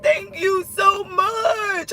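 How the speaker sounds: high, slow, tearful cry of thanks. Retold or imitated. Thanking her aunt on the phone, crying hysterically. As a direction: sob out the thanks, very slowly. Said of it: "I'm crying hysterically again".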